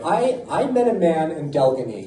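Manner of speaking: usual speaking voice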